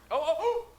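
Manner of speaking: high, gasping excitement; excited. Real, not acted. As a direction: squeal with relief. Discord finally loads.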